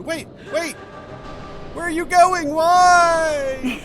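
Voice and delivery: very high pleading turret voice; squeaky robot turret crying out. Retold or imitated. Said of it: "the Portal turret voices".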